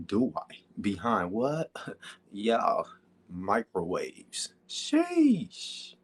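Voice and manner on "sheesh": a long squeaky falsetto "Sheesh" that falls away. Real, not acted. A hyped player reacting with a falsetto sheesh. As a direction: stretch "Sheesh" way up high, then let it drop. Hype exclamation in voice chat.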